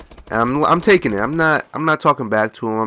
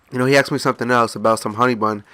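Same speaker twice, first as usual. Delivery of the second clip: ordinary delivery, nothing marked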